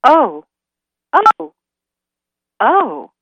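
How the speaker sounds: high, loud little ohs; flustered high-pitched surprise; startled deer. Acted. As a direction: separate exclamations, pitch jumping up. Deer reacts to mystery voice.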